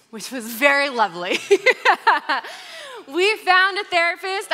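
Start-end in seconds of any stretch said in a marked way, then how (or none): 1.4-3.0 high, loud burst of laughter; laughing at herself, higher and louder; real bright laugh. Real, not acted. Laughing at her own story. 3.1-4.5 said through a high, loud laugh; real laughter, higher and louder. Real, not acted. Laughing at her own story.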